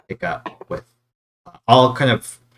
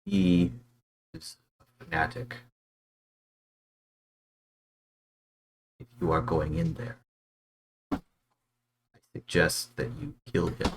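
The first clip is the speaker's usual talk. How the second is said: whispering; 2 semitones lower, 6 dB quieter than usual. Acted. Guards secretly informing an outsider about their lord, whispered. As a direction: confide it softly, with long pauses. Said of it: "they kind of whisper".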